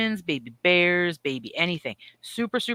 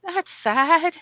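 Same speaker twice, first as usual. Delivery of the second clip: high sing-song aw; a high cooing aw of sympathy; mock pity; 9 semitones higher, much slower than usual. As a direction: coo a little pity. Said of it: "I'm like".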